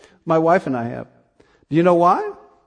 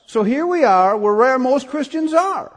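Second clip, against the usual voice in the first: normal talking voice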